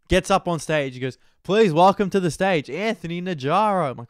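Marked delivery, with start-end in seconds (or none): 1.4-3.9 raised, louder announcer delivery; emcee introduction. Retold or imitated. Emcee bringing his friend on stage, projected announcement. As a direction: announce the act to the room.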